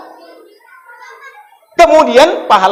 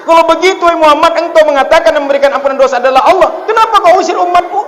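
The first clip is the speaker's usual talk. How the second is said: indignant challenge; 7 semitones higher, faster than usual. Acted. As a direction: demand an answer, voice rising. Angel challenges the Prophet. (malaikat menegur Nabi dengan suara tinggi dan mendesak)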